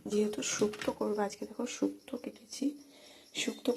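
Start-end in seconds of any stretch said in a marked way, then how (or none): none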